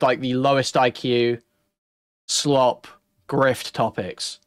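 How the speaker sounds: ordinary talk, nothing marked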